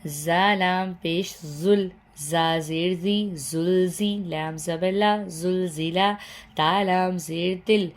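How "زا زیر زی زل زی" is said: ordinary talk, nothing marked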